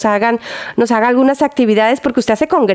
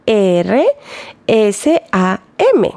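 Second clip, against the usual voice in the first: spelling slowly; 3 semitones lower, much slower than usual. Shown as a demonstration. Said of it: "Ersam es con H intermedia".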